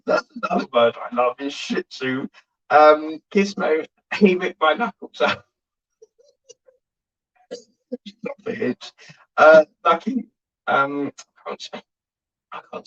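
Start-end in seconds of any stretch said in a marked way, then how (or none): none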